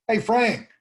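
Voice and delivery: high, loud hail to "Frank"; calling out. Acted. Somebody behind you yells your name, calling out. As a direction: call to someone you know from behind.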